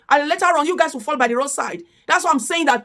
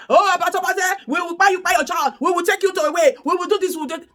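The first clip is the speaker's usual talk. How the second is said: mock threat; 5 semitones higher than usual. Mocking the senders who threaten to buy him, mock threat. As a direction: sneer it like an online bully. Voicing threatening messages he receives.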